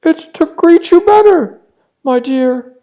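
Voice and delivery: very high, loud fake granny voice; sugary fake grandma; wolf as grandma. (the disguised wolf answering the girl, fake granny voice)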